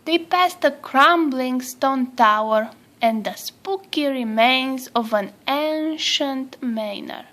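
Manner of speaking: ordinary delivery, nothing marked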